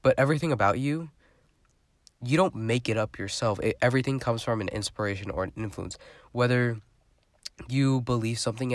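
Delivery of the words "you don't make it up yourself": ordinary delivery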